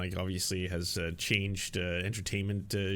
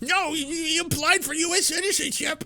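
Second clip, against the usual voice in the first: way higher, louder, cartoonish American cheer; mock patriotic fan; 6 dB louder than usual. Mocking Americans claiming the rapper, shrill hammed-up patriotic cheer. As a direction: ham up a flag-waving fan. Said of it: "all y'all are just like".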